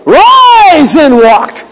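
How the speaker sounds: high, loud, long-drawn miracle command; commanding shout. Pretend. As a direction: bellow it like raising the dead. Imagined command to the dead.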